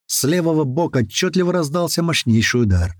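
usual speaking voice

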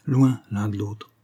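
slow, cold and settled; coldly final. A narrator's reading. Husband coldly telling his wife they will live apart, slow and final. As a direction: close the matter slowly and coolly. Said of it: "husband's cold final words".

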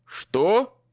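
loud, high startled cry; startled shout. A narrator's reading. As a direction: blurt out in shock. Startled exclamation.

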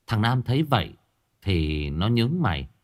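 ordinary delivery, nothing marked

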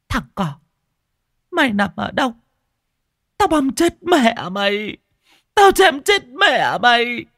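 high, slowed, furious shriek of a mad mother; crazed threats. Acted. Crazed mother slashing at graves. (người mẹ hóa điên chém đất, thét cao chậm và giận dữ)

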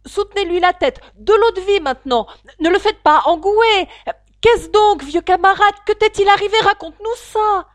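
appels aigus et forts; voix confuses agitées. Acted. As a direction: des voix pressées autour du blessé. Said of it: "un mélange confus de voix". (voix agitées qui s'affairent, aiguës et fortes)

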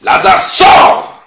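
huge high-pitched command, drawn out; loud cry. Acted. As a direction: shout it at full force to raise the dead. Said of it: "il cria d'une voix forte". (cri d'une voix forte)

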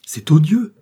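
high, loud cry of indignation; outraged exclamation. A narrator's reading. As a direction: exclaim in open disgust. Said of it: "s'écria Paulin Broquet".

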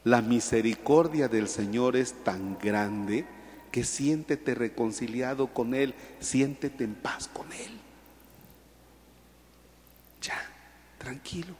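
soft, whispered reassurance; hushed consolation. Acted. Priest soothing a penitent sinner with a soft whisper. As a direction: whisper peace to the sorry soul.

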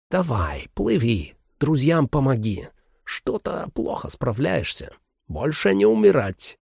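a hint of an odd old man. Old man urges him on.

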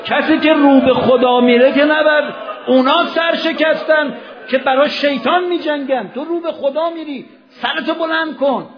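high, loud rallying cry; fervent exhortation. Acted. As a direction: lift the crowd, voice ringing high. Preacher exhorts raise your head. (فریاد پرشور و بلند)

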